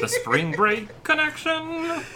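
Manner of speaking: high, slow frog puppet voice; Kermit the Frog voice. Acted. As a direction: sing it like a muppet frog. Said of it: "It's not a bad Kermit the Frog impression".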